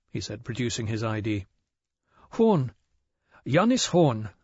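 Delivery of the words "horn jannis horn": high, nervous shopkeeper voice; a jittery small-town shopkeeper. A narrator's reading. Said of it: "said the shopkeeper with a nervous smile".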